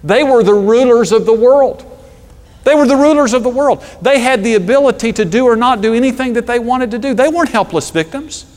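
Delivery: high, loud passionate preaching; full-force preaching. Real, not acted. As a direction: proclaim it with fire. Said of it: "preaching at full force".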